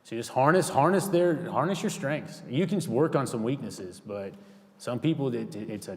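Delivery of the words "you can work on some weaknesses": ordinary talk, nothing marked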